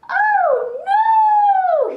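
very high, loud, drawn-out cry; alarmed shout. Acted. Gecko waking to see a snake, shouting in alarm. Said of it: "he shouted".

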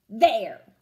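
loud, punchy There!; defiant shout. Acted. Vashti to teacher, jabbing the paper, defiant shout. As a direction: slam it down like a jab.

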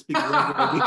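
laughing through the question, speeding up; words breaking into a real laugh. Real, not acted. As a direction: giggle it out as you ask. Host laughing at his own question.

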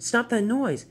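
high-pitched urgent plea; startled storybook engine voice; alarmed. Acted. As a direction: plead in mild alarm. Thomas startled by loud noise.

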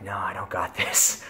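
whispered no, half panicked. Retold or imitated. Tripping guy answers his own pep talk, scared whisper.

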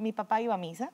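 normal talking voice

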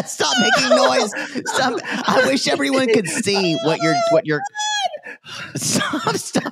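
laughing. Real, not acted. Laughing at friend's cramp.

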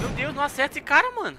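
exasperated. Real, not acted. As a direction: cry it out in disbelief. Frustrated after missing shots.

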